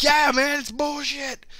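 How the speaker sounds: high, slow whiny complaint imitating Seth; mock angry friend. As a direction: drag it out like a sulking gamer. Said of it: "He's like".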